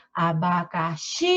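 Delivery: ABACAXI slow, with a long XI; "a-ba-ca-xiii", last syllable held; stressed last syllable. Shown as a demonstration. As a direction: stretch the final XI of Abacaxi. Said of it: "A sílaba mais forte de abacaxi é o xi".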